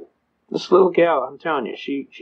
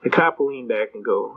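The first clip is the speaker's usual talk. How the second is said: ordinary talk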